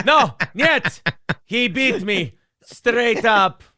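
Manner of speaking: put-on high, slow, hammy Russian accent. Quoting the beaten movie villain, slow Russian accent. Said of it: "She doesn't like his Russian accent".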